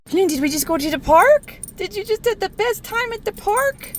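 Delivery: high, loud, gushing praise for the dog; excited dog talk. Real, not acted. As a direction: bubbly voice for a happy puppy.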